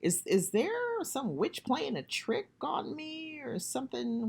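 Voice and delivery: replaying her spooked thoughts, slowed right down; scared inner thought. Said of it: "I thought".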